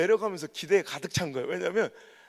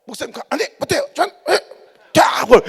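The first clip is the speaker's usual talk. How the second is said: blunt refusal; 7 semitones higher, 10 dB louder than usual. Retold or imitated. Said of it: "이게 적응이 안 되는 게".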